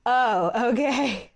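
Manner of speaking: slow oh with a laugh through it; relieved laugh. Retold or imitated. Groomer answering the forgiving client, laughing with relief. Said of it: "I was like".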